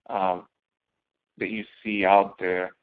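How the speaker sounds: usual speaking voice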